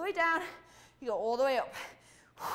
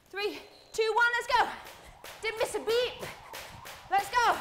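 rallying shout; 8 semitones higher than usual. Real, not acted. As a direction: launch the set with full energy. Coach counting into a set.

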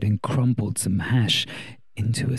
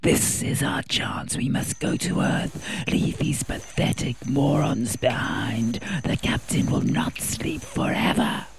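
slow, scheming whisper; hissed whisper; 3 semitones higher, slower than usual. Acted. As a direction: hiss it slowly and coldly. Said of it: "Pyro hissed".

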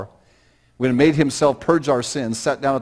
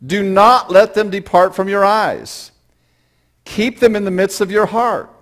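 higher, louder, slow scripture reading; preaching at full force; 10 semitones higher, 9 dB louder, slower than usual. Acted. A pastor preaching the proverb to his church, loud and slow. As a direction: proclaim the verse to the congregation.

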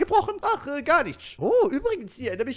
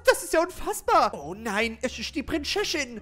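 ordinary talk

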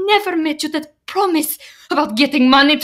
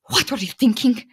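ordinary delivery

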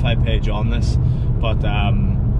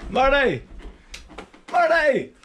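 calling up to window; slower than usual. Real, not acted. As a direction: call up to him, high. Calling a mate at the door.

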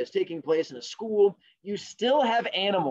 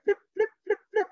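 page-flipping sound. Acted. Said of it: "He's like".

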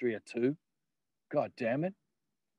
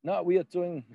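Frisian accent attempt; 7 semitones higher than usual. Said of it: "I'm trying to think of this guy's accent".

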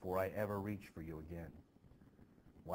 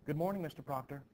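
lightly voiced young Abigail, pert and teasing; Abigail, higher; 6 semitones higher, 4 dB louder than usual. Abigail taking leave of Mister Proctor, lightly voiced higher.

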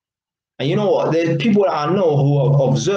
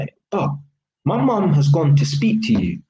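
ordinary talk, nothing marked

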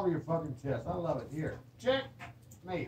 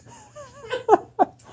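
high cackle; 10 dB louder than usual. Real, not acted. Loud laugh.

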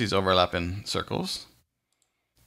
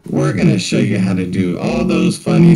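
super low pitched voice; 10 dB louder than usual. Shown as a demonstration. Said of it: "super low pitch here".

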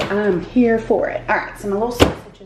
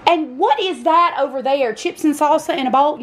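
playful scolding; 7 semitones higher than usual. Real, not acted.

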